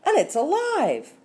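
high, loud cry of horror; shouted. A narrator's reading. Onlooker spots the creature in her hair, horrified shout. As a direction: climb to a high alarmed yell. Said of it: "another person shouted".

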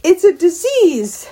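high, stretched-out panicky cry; screamed alarm. A narrator's reading. Mother warning daughter off the squirrel, screaming in alarm. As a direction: wail it high and slow in revulsion. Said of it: "screamed her mother".

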